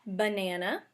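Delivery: ordinary delivery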